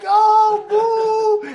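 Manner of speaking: high, loud stretched heckle; crowd jeers. Retold or imitated. Crowd turning on the mayor, high loud jeering. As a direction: boo like a whole angry crowd. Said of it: "You hear it".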